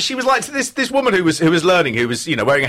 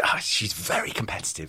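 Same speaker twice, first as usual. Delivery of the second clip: whisper; 4 semitones lower, 9 dB quieter than usual. Real, not acted. Confiding aside about a contestant.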